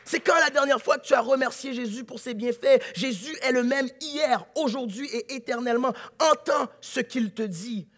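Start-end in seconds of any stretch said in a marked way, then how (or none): 0.0-5.9 preaching. Real, not acted. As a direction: preach it at full force. Preaching at full force. (prédication fervente)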